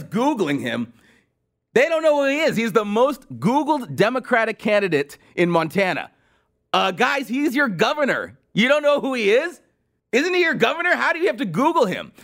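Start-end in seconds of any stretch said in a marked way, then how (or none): 1.7-12.1 high-pitched mock disbelief; incredulous. Real, not acted. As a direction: rant as if astonished by their ignorance. Mock disbelief at Montana googling governor.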